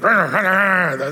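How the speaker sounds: wordless scolding "rah-rah" noise; cartoonish angry blather; mock angry yelling. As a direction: imitate someone ranting, in nonsense syllables. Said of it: "you're scolding or yelling at them".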